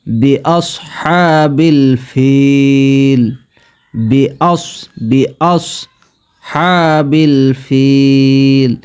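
বিআসহাবিল ফিল with a long drawn alif, slow. Shown as a demonstration. Teacher demonstrating madd, very slow stretched alif. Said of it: "এক আলিফ টানতে হবে".

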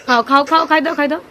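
loud pleading mother; coaxing loudly. Real, not acted. Mother coaxing sick child to eat.

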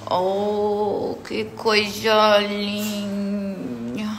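very slow, stretched "que coisa linda"; very slow doting coo at the puppy. Real, not acted. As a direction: melt over the cute puppy. Doting on the dog.

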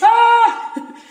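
loud high "Ah!" of alarm; a sudden shocked cry, very loud; startled yelp. Retold or imitated. Reacting to hearing it's one A M with a yelp. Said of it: "Just like that. I yelled just like that".

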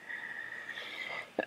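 soft breathy chuckle; quiet wordless laugh to yourself; low-key giggle under the breath. Real, not acted. Laughs at own failed puzzle attempt.

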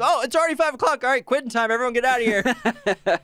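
high, excited imitation of a boss; hammed-up eager quitting-time cry; mock surprised announcement. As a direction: call it out to the whole office. Quoting Michael waking up, fooled.

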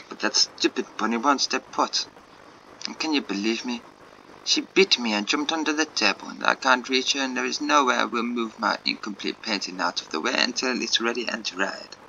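put-on amateur French accent, lively complaint. Artist complaining to Jacob about the bunny, French accent. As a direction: rant lightly as a French artist. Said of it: "so many shit French accents".

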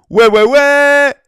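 slow, loud, drawn-out youthful yeah; failed young-guy voice. Pretend. Streamer trying a youthful yeah for chat, slow and loud. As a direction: ham up sounding young and botch it. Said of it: "un peu de jeune, mais j'ai foiré".

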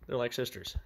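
normal talking voice

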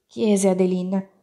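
ordinary delivery

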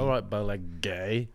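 slow soft "Beleg day"; apostrophe on the E. Shown as a demonstration. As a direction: say "Beleg day" slow and low, as if there's an accent mark. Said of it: "Imagine that there's an apostrophe over the E".

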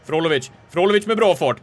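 excited play-by-play. Real, not acted. Commentator following Frolovic's run at goal, mounting excitement.

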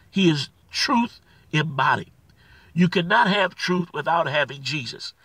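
ordinary talk, nothing marked

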